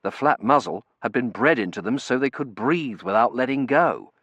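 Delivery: usual speaking voice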